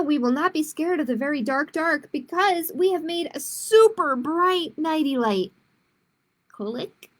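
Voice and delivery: put-on reading to children, clear and lively; picture book read aloud; story narration. A narrator's reading. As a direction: tell it like storytime for kids.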